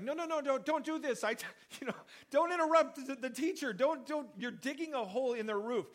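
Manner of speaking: high, flustered pleading; lightly hammed-up panicked whisper-shout; mock protest. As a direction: play the embarrassed man begging them to stop. Paralyzed man imagined protesting.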